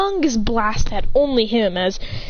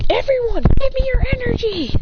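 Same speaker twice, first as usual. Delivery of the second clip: high-pitched rallying cry; urgent high call for power; Akane; 13 semitones higher, 4 dB louder than usual. Acted. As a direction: call out to the whole team, voice way up. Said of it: "Akane goes".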